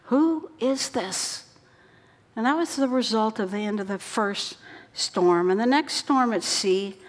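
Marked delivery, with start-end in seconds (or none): 0.1-1.4 awed question. Acted. Disciples awed after the storm.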